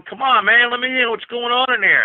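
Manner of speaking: high, loud call through a locked door; mock plea shouted from outside the door; pleading through door. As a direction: yell to be let in, half-mocking. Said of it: "Blackie's out there banging on the door going".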